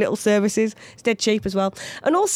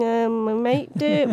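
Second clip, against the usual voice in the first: evasive drawl; much slower than usual. Retold or imitated. Landlady evasive reply.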